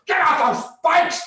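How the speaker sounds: high, loud furious yell; enraged park ranger's order; angry ranger. Retold or imitated. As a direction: shout it like his head will explode. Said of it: "he's so angry".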